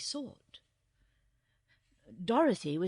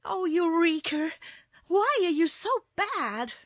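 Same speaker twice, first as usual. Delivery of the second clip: high, loud, dismayed scolding; exasperated little girl; 10 semitones higher, 8 dB louder than usual. A narrator's reading. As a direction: scold the kitten in a high girl's voice. Girl scolds her disobedient kitten.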